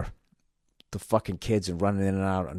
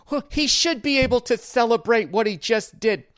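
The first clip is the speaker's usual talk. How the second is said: mock whiny defender; 11 semitones higher, 3 dB louder than usual. Mocking defenders of celebrations.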